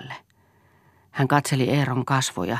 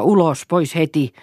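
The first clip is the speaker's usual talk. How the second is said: raised, loud command; shouting; 4 semitones higher, 6 dB louder than usual. A narrator's reading. Father pushing son to the door, shouting. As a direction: drive him out with your voice. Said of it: "huusi Eero".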